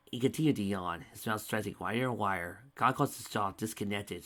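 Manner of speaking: ordinary delivery, nothing marked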